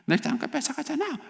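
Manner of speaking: put-on very high, whiny child complaint; upset child. Child complaining to parent about younger brother, upset child voice. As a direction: squeal the grievance like a small child. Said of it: "막 이렇게 말을 하면서".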